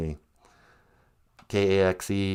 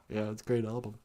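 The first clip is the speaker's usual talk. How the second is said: ordinary talk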